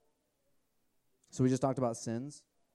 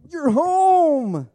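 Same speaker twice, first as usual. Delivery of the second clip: much higher, loud joyful cry; joyful welcome; 16 dB louder than usual. Retold or imitated. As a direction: cry it out like a father embracing his son. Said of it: "he's like".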